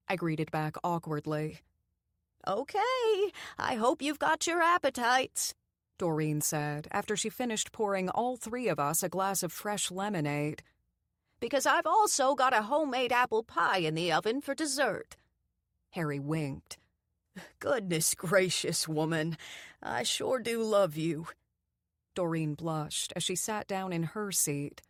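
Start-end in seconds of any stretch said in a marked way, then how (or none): 2.5-5.6 high, louder doting grandma; a hint of a proud old cook; cheery old lady. A narrator's reading. Doreen cheerful. 11.4-15.2 high, louder doting grandma; a hint of a proud old cook; cheery old lady. A narrator's reading. Doreen cheerful. 17.6-21.3 a hint of a doting old man; affectionate old man. A narrator's reading. Harry affectionate.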